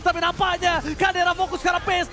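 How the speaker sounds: high excited play-by-play; fighting game announcer. Acted. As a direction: keep the hype calling. Hype play-by-play continues.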